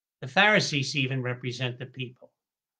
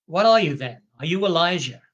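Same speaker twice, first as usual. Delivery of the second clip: louder, higher challenging questions; pressing question; 3 semitones higher, 5 dB louder than usual. Acted. Priests confronting John, louder and challenging. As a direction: demand an answer from him.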